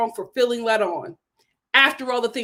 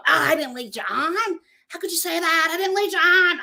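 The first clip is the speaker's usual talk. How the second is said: higher, defensive mock protest that rises to a shout; imitating Shane; 6 semitones higher than usual. Shane denying he led Natalie on, in a mocking imitation. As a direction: play him as a wounded guy denying everything. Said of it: "let me do his voice".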